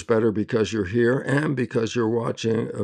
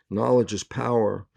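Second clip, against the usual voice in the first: ordinary delivery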